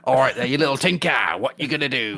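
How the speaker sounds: lightly put-on Cockney Cenobite. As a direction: play a cheeky Cockney demon. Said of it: "slightly Cockney working class Cenobites".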